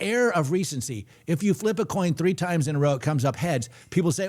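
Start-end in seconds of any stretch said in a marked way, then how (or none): none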